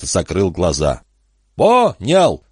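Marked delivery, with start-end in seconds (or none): 1.5-2.4 high, raised shout; high-pitched called-out reply; shouting back. Acted. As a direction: holler an acknowledgement across a distance. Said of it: "крикнул Эндерс".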